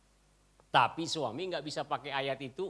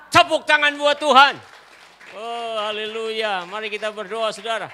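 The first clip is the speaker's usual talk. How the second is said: loud, higher, drawn-out call to clap; rousing preacher call; 7 semitones higher, 13 dB louder, slower than usual. Acted. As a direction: lift the crowd into praise. (seruan khotbah yang membakar semangat)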